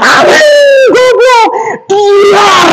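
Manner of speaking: high, anguished shouted curses. Acted. Student reading a protest poem, screaming the curses. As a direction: scream the insults like a victim being beaten. Poem acting out beating scene.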